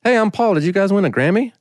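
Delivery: a bright mock Paul voice, no accent; imitating Paul. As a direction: play the cheery famous guest. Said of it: "and he goes".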